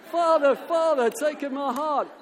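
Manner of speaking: very loud, high crowd chant; shouting full-voice. Acted. Crowd pleads as the feather drifts down, shouting at full voice. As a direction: belt it out like a desperate crowd. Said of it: "shouting at the top of their voices".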